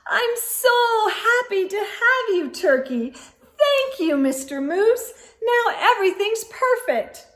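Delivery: high, delighted hostess voice; delighted Missus Moose. A narrator's reading. Wife thanks husband for turkey, delighted. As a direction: gush with delight.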